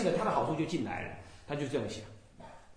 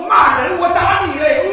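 much louder and higher, fierce retort; angry shout; 11 semitones higher, 15 dB louder than usual. Retold or imitated. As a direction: roar it back as a fiery rebuke. Master shouts back at caller. (法师对电话那头的人怒吼回去)

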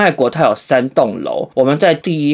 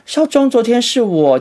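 nurse; 9 semitones higher than usual. Retold or imitated. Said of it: "护理人员就来说".

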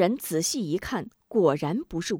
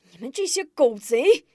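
furious roar; 7 semitones higher than usual. Acted. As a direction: roar curses through the bars. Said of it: "愤怒地摇着铁栏大吼".

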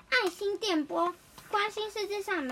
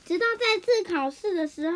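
plain reading voice

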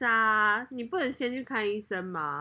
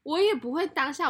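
normal talking voice

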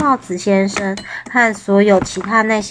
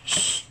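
soft, voiceless "shh"; quiet hushing sound; hushing shush; 13 dB quieter than usual. A narrator's reading. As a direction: gently shush someone. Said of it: "帽子先生试图告诉他".

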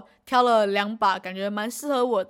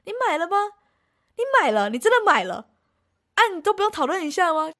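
mock astonished questions, pitch jumping higher; imitating mom's shock; 7 semitones higher, 3 dB louder than usual. Mother on the phone learning her daughter bought a viola, imitated shock. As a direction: replay mom's disbelief on the phone, shocked and rising. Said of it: "用非常惊讶的语气跟我说什么".

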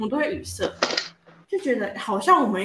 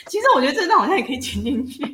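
laughing; 4 semitones higher, faster than usual. Real, not acted. Laughing after a flubbed opening.

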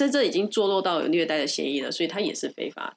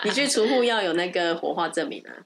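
normal talking voice

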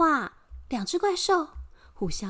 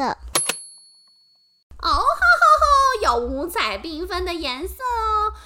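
high drawn-out cackle; gloating monster laugh; 5 semitones higher, 5 dB louder, slower than usual. Acted.

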